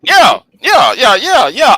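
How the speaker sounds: loud, excited yeahs. Real, not acted. Streamer loudly agreeing with chat. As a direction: burst out agreeing. Excited reaction, shouting yeah.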